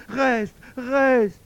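high, loud desperate plea; agonised howl. A narrator's reading. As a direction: howl for her to stay. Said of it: "un hurlement d'agonie".

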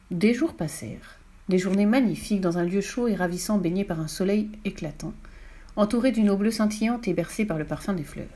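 usual speaking voice

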